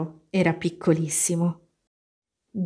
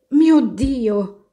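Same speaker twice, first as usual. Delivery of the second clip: loud, high cry in an altered voice; storybook yell of shock; startled cry; 5 semitones higher, 6 dB louder than usual. A narrator's reading. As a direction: cry out in alarm. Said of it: "urlò e lasciò cadere il suo orsetto".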